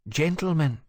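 calm, firm interruption; a hostess settling the room; composed lady. Acted. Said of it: "Edith said, breaking into this charged atmosphere".

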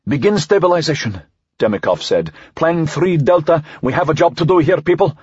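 rally a team into action; commanding team leader. A narrator's reading. Team leader with a job to do, commanding.

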